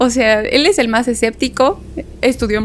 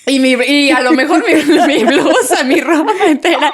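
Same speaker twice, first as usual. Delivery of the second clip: laughing; 4 semitones higher, 3 dB louder than usual. Real, not acted. Laughing while telling ghost story.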